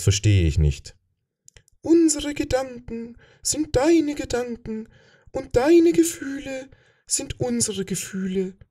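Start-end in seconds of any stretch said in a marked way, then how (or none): 1.8-8.6 hohes, langsames Flüstern einer fremden Macht; fremdes Wesen. Acted. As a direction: wie ein körperloses Kollektiv aus dem Nebel flüstern. Das Fremde antwortet. (hohe, langsame, geflüsterte Stimme eines fremden Wesens)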